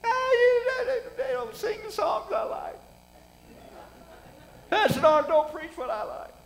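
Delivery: hammed-up crybaby church member complaining; whiny church baby. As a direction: wail the complaints like a spoiled crying baby. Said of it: "They cry".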